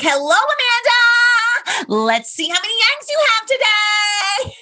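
high, slow, sing-song cheer; chipper nurse. Retold or imitated. Imitating the ultrasound nurse greeting her patient, chipper and high. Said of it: "very chipper".

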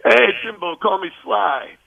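a hammed-up Stallone greeting; Sly's voice. Stallone greeting Jim on the phone, hammed-up and slow. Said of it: "And I said".

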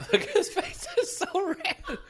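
high squealing laughter; laughing. Real, not acted. Laughing at friend's red face.

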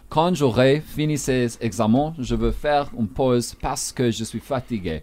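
the full French sentence read with clean pronunciation; French demo. Shown as a demonstration. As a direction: model the French sentence for the listeners. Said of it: "I'll give you a sense".

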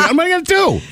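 high-pitched, frantic self-questioning; retold panic. Husband recalling drive home to a bird, mock panicked.

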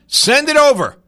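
loud, high-pitched call. Real, not acted. Host calling on listeners for an angry song, calling out. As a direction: punch it out to the audience.

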